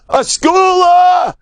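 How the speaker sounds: long, slow, high ringing cry; exultant shout. Acted. Rabbi telling the slow listener it's a blessing, drawn-out high shout. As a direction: stretch the word out in triumph.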